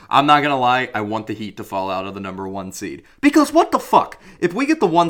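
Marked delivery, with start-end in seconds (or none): 3.2-5.1 shrill, loud disbelief; what the fuck, yelled high; high outraged yell. Real, not acted. Ranting about the seeding.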